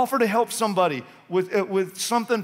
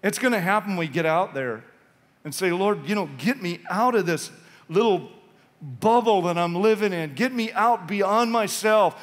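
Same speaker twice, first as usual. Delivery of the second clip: ordinary talk, nothing marked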